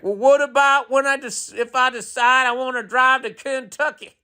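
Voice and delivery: high, whiny mock skeptic; whiny parody of a gas-car driver; mocking gas car owner. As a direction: mimic a fussy doubter way up high. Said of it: "gas combustion people are like".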